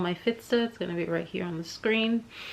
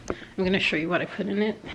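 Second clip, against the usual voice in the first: normal talking voice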